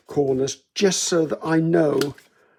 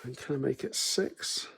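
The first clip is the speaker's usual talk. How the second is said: softer, under-breath working out; muttering to myself; 7 dB quieter than usual. Real, not acted. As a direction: mumble numbers to yourself. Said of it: "sorry, I'm muttering to myself".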